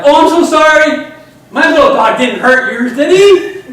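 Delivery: very high, loud squeaky mock apology; over-the-top apology. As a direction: cry it out in an exaggerated falsetto. Voicing his mock apology to dog owner.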